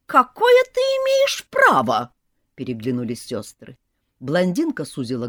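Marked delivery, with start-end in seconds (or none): 0.1-2.1 high, slow, indignant voice of a snake sister; shrill indignant aunt. A narrator's reading. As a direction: demand it with outrage, pitched high.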